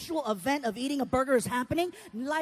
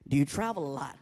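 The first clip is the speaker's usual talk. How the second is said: low-voiced doctor; much lower doctor voice; 9 semitones lower than usual. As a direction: ask it deep, as the consulting doctor. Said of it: "doctor walks in".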